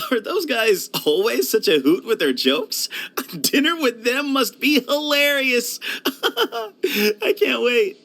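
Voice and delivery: high, giggly excitement; laughing through the words, pitched well up; amused delight. Acted. Looking forward to dinner with joking friends, laughing delight.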